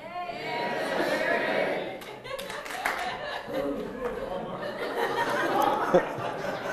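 long breathy laugh; laughter. Real, not acted. Laughing at his own cheer.

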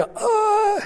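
very high held gasp of wonder; hammed-up awed noise on one note; mock swoon at a treasure; awestruck "uhh". Retold awe at Spurgeon's Bible.

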